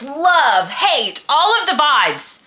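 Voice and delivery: normal talking voice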